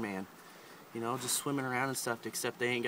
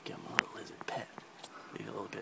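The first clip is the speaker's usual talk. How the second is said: quick hushed whisper; 5 dB quieter, much faster than usual. Acted. Host reaching out to pet the lizard, fast whisper.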